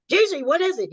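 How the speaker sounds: put-on a mother startled by her child's tears; mother suddenly seeing her daughter in tears, alarmed. Mother sees daughter crying.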